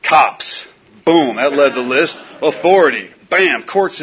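loud punched one-word bursts; venting resentments. Acted. Man writing his list of resentments, venting.

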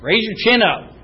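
high, loud barked orders; mock guard orders. Guards ordering a shy speaker to speak up, barked. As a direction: play a drill sergeant barking. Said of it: "it's like they had guards on me".

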